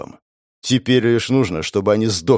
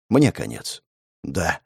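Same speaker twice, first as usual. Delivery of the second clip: ordinary delivery, nothing marked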